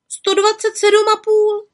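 high witch stating her age; little witch. A narrator's reading. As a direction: announce it proudly, high.

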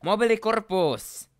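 high, very loud incantation; shouted spell. Acted. Draco casts at Harry mid-duel, shouted spell. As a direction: belt the spell out.

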